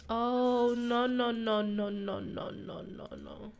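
string of dismayed no's fading away. Real, not acted. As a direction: let the no's run on, dying down to nothing. Dismay as game goes wrong.

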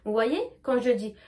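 ordinary talk

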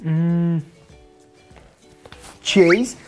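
plain reading voice

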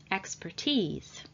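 expertise, slow, ending in a long EE; long final vowel. Shown as a demonstration. Teacher models expertise for learners, with a long final EE. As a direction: draw out the "ease" in expertise. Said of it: "ease is pronounced as a long vowel".

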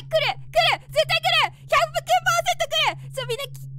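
high, loud, rising panic; excited dread. Real, not acted. Streamer warning viewers a scare is coming, high and panicky. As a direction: whip yourself up for the coming scare. Bracing for a game jumpscare.

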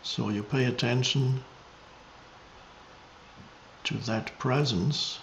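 meditative teaching, measured and spacious; contemplative spiritual guidance. Real, not acted. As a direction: guide listeners into presence, unhurried.

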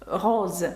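"Роз" ending in a voiced Z; "Роз" with a buzzing Z ending; S read as Z. Shown as a demonstration. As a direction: say French "Роз" with a final Z. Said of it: "літера С читається як З".

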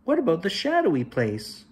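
a small cub asking about something new; curious young cub. Acted. A young cub asks his father, lightly voiced and curious. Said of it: "Then he asked".

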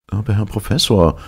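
usual speaking voice

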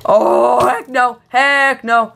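high, drawn-out cries of retreat; mock-panicked refusal. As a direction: bail out on your friends in playful panic. Hero abandons friends to monsters.

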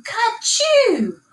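high sneezing "Ka-choo"; a big high sneeze from the cow. A narrator's reading. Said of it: "I heard the milk cow moo".

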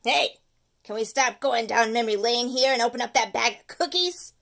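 loud, pushy candy craver; demanding tooth. Acted. The tooth demanding peanut butter cups, loud. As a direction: bark for candy like a greedy monster.

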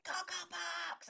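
high, drawn-out call across a park; mock yell of a passer-by; stretched-out shout of the show name. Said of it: "top of their voice".

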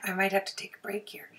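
fast, rasping whisper; hurried hoarse whisper; losing her voice. Real, not acted. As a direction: voice giving out from a sore throat. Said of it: "you can tell I have a sore throat".

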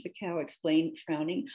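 ordinary delivery

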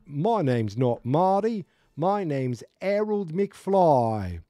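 broad put-on English kid, slow and high; mock English accent. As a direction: draw it out in a hammed-up English accent. Voicing English kid correcting them.

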